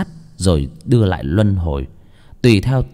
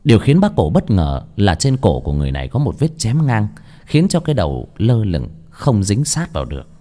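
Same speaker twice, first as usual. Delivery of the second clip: ordinary delivery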